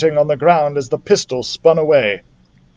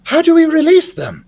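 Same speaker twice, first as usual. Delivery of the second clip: put-on young girl voice; 13 semitones higher, 3 dB louder than usual. A narrator's reading. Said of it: "asked Beatrice".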